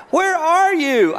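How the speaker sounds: high, slow, urgent question; alarmed dad. Retold or imitated. Father asking the boy in the trunk, high and slow with alarm. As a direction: stretch it out in disbelief. Dad on 2am phone call.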